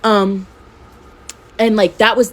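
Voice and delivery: normal talking voice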